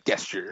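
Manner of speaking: "Gesture" with a hard G, guesture. Shown as a demonstration. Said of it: "with a hard G".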